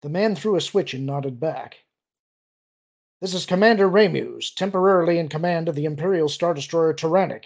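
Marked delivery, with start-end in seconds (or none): 3.2-7.5 a hint of a stiff, formal officer bark; louder, faking rigid military diction; stiff Imperial imitation. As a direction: Booster bluffing the enemy admiral with stiff Imperial formality. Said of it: "his best imitation of a typical Imperial's overly stiff speech".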